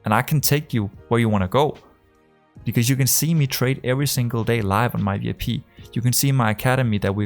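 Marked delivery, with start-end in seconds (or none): none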